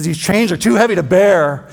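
lightly voiced ghost under heavy chains; Jacob Marley. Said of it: "He says".

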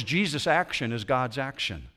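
normal talking voice

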